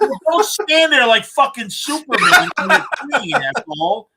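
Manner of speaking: high, indignant, laughing through it; angry retort. Retold or imitated. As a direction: snap back at him, half laughing. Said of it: "And he goes".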